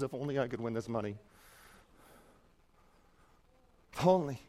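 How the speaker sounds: wistful dreamer. Acted. Wistful lottery dreamer voiced.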